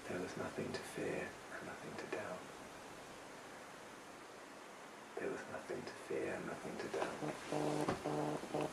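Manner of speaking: very soft, nearly whispered refrain; hushed fading recitation. Acted. Storyteller closing Pyramid Song for listeners, hushed and fading. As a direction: let the repeated line fade almost to nothing. Song's last line repeated, fading away.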